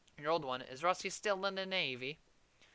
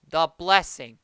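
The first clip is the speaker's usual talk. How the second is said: much louder, spelled out for a deaf ear; loud explaining; 12 dB louder, slower than usual. Acted. Uncle shouting to the deaf aunt, loud and plain. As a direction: shout it so the old aunt hears.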